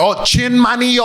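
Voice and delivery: put-on slow American-accented "Chin Mario"; dumb American accent. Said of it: "I'm like the dumb American".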